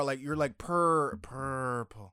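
slow 'purple' with a long held R; P-R-R purple. Retold or imitated. Co-host imitating the host's word, drawn-out R in 'purple'. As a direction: stretch the R in 'purple', PURRR-pul. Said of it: "It's P R R".

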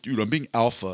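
ordinary talk

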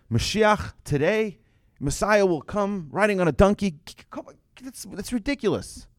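high, mocking disbelief at the idea; high, breathy scoff of a doubter; scoffing skeptic. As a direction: sneer it like a doubter who finds it absurd.

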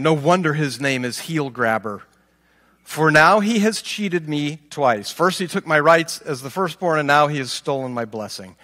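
normal talking voice

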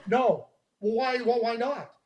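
normal talking voice

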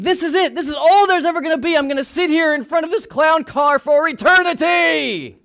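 high, loud, over-dramatic wail of complaint; mock despairing outburst. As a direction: act out being stuck forever, loudly. Acting out panicked fixation on clown car.

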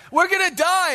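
hammed-up fear; panicked disciples. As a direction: shriek it like sailors about to drown.

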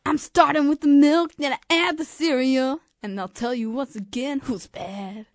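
lightly voiced pop star impression. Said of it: "can I be [name]?".

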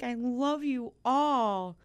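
tearful. Real, not acted. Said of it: "And now I'm crying".

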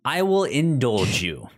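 ordinary talk

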